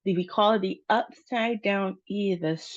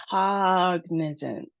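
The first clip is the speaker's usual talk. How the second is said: "Cognizant" slowed, with a long "Cog"; first-syllable stress; slower than usual. Shown as a demonstration. Teacher repeating it for learners, slow first-syllable stress. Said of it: "Emphasis on the first syllable".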